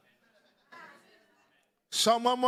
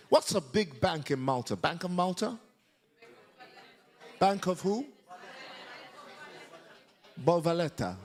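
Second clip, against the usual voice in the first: ordinary talk, nothing marked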